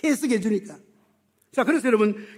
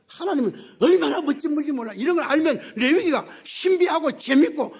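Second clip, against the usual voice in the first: ordinary talk, nothing marked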